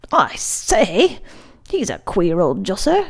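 put-on boyish exclamation with swooping pitch; exclaiming. A narrator's reading. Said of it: "Mahoney exclaimed again".

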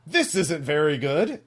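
mildly hammed-up picky villain; Mister Cat. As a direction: complain like a storybook cartoon animal. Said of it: "Mister Cat eats the cake and says".

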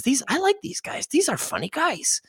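a squeaky, amused bandit boss; El Guapo. Retold or imitated. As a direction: play the bandit chief tickled by the gringos. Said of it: "He, he says".

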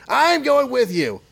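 high, slow boss voice; boss insisting. Acted. Jonah insists on coming along.

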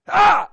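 sharp high yelp of pain; mock yelp at scalding water. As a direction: yelp like the water's too hot. Toe into hot tub.

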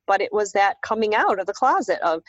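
normal talking voice

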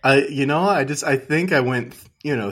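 ordinary talk, nothing marked

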